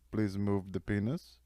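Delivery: put-on slow, lightly accented doctor voice; a hint of an Indian accent, slow; mock Indian doctor. Doctor instructing during surgery, mild Indian accent. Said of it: "last thing he heard was Vikram going".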